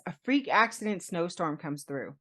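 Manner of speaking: normal talking voice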